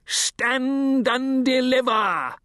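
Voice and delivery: high, slow commanding shout; highwayman shout. A narrator's reading. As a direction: bellow it from the road. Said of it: "cried a voice taut with peril".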